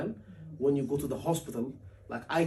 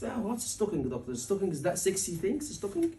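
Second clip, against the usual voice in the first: puzzled patient; 7 dB quieter than usual. Retold or imitated. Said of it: "You see the way I'm talking?".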